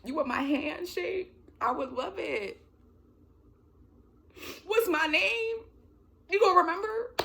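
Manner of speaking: high-pitched pretend fangirling, building; mock overwhelmed fan. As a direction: squeak it out like a starstruck fan.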